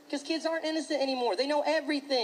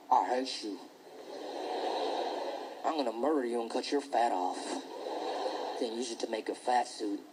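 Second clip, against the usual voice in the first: menacing bully; 12 semitones lower than usual. Retold or imitated. Bully on the bus to another kid, low and menacing. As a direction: growl it low like a creepy little psycho.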